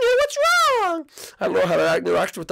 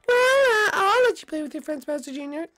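mom voice; 12 semitones higher than usual. Acted. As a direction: speak as a gentle mom to her son. Mother figure answers her son.

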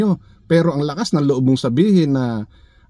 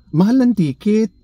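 higher mocking echo of the rival; mocking imitation; 5 semitones higher than usual. Vlogger mimics rival's ticket excuse, mocking. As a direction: parrot it back in a whiny mock voice.

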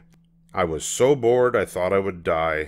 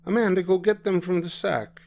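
mother voice; 11 semitones higher, faster than usual. Acted. Mama voiced.

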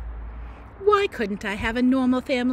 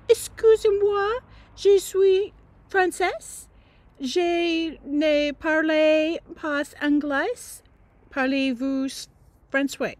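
higher, slow, hammed-up French accent; girl faking French for a handsome boy in a French accent; put-on French accent; 5 semitones higher, 3 dB louder, slower than usual. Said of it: "just being French".

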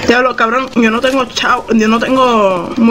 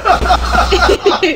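kind gentle laugh; 8 semitones higher than usual. Real, not acted. Reacting to gift.

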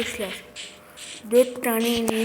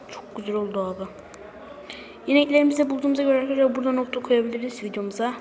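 usual speaking voice

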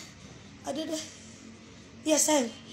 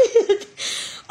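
high, airy laugh; real laugh; 5 semitones higher than usual. Real, not acted. Streamer thanking a sender, high helpless laughing. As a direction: break into voiceless high laughter. Overwhelmed by viewers' gifts.

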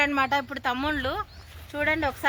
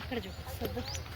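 much softer, repeated under the breath; quieter repeat; 3 semitones lower, 13 dB quieter, slower than usual. Real, not acted. Mother repeats to the cameraperson to film here, much softer. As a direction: say it again, softly, almost to yourself.

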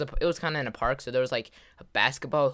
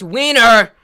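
sudden high, loud yell; goofy one-word holler; silly shout; 7 semitones higher, 15 dB louder than usual. Acted. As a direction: blurt it out at full volume.

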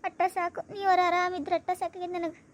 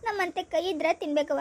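ordinary talk, nothing marked

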